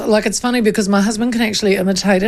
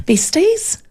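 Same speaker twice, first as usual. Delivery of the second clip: ordinary talk, nothing marked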